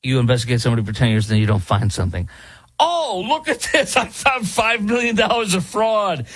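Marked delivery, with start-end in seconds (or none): 2.8-6.3 1 mock surprise. Mocking the investigators' discovery.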